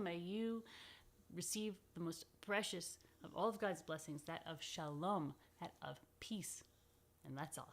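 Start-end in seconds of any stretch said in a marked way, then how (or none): none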